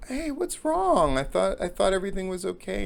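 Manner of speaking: high, coaxing "what's wrong" voice; acted concern. Jogger consoling her on the third day, high-pitched concern. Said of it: "just goes like".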